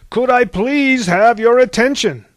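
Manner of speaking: loud, high-pitched call for attention; booming, fed-up plea; exasperated demand. A narrator's reading. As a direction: raise your voice over the chatter. Zeus demanding to be heard.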